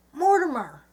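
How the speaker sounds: high, loud cry of a name; startled exclamation. A narrator's reading. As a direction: exclaim it in sudden astonishment. Eleanor startled by Mortimer's sudden appearance.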